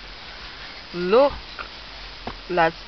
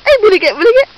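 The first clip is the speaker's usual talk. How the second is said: high, loud peekaboo bursts; playful boo; 6 semitones higher, 9 dB louder, much faster than usual. Pretend. Mother surprising her baby in peekaboo, loud high playful bursts. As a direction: startle the baby in fun.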